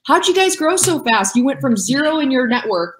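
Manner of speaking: high-pitched mock amazement; imitating amazed askers. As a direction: play the wide-eyed asker, voice up high. Voices people asking about their growth.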